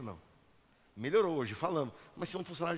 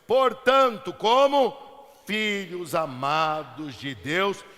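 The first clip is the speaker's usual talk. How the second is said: very slow, stretched, declaimed verse; solemn scripture reading; 8 dB louder, much slower than usual. Real, not acted. Preacher reading Ephesians to the congregation, solemn scripture reading. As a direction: proclaim each word slowly, dropping at the end. Reads a Bible verse aloud.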